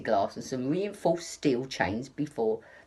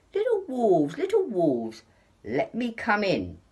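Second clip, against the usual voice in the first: high, sing-song pig voice; pig calling sweetly; 6 semitones higher, 4 dB louder than usual. A narrator's reading. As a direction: coax the wolves from outside the door. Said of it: "The pig pressed the video entrance phone and said".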